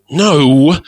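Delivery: cross. A narrator's reading. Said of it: "I interrupted crossly".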